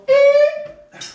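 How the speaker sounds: very high, loud "Eep"; high squeak. Shown as a demonstration. Showing the crowd its cue sound, high squeak. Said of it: "you're gonna go".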